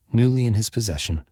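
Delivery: plain reading voice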